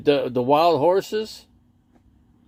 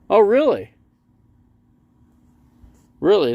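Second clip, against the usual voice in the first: surprised; 10 semitones higher, 8 dB louder than usual. Real, not acted. Reacts to chat news.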